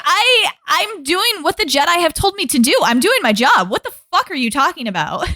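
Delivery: a hint of a fed-up Anakin; mildly hammed-up protest; mock Anakin outrage. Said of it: "Anakin's like".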